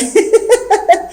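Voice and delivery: a squeaky, airy high giggle; high breathy giggle. Acted. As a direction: burst out again. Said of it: "Nicole n'arrête pas de rire".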